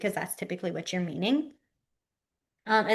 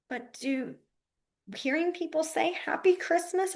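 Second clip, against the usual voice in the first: imitated questioning, pitched up high; puzzled coworker; 7 semitones higher, 5 dB quieter than usual. Coworker questioning the teacher's wording, mildly puzzled. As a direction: query it in a higher, softened voice.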